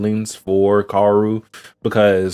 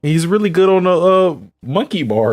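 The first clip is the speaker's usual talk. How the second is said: retold child; 7 semitones higher than usual. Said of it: "She's, she's like".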